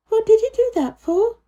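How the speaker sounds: put-on eager child asking; curious Mary. A narrator's reading. Mary asking about the robin, high and eager. Mary's voice.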